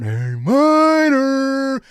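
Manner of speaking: high, loud, drawn-out chant; imitated crowd yell. Sixty thousand fans at the Super Bowl, yelling out. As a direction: stretch it out like a stadium crowd. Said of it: "sixty thousand people yelling out".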